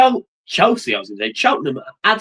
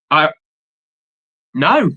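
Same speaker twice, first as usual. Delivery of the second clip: normal talking voice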